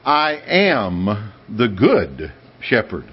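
slow, weighty, every word stressed; emphatic declaration. Retold or imitated. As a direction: declare it slowly and firmly. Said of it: "when he declared emphatically".

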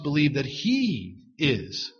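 slow down and lean on "he"; stress on HE. Shown as a demonstration. Preacher urging belief in God, stress on "he". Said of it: "make more emphasis on that word, he".

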